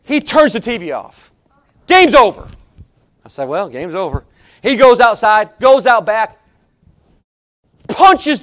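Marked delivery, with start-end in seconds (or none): 1.9-2.5 loud, higher, abrupt verdict; mad declaration. Retold or imitated. Preacher mimics friend mad at the game, angry. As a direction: imitate a fuming fan calling it quits.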